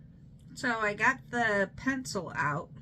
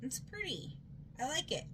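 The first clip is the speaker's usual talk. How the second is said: soft; 4 semitones higher, 11 dB quieter, much faster than usual. Real, not acted. Soft admiring comment.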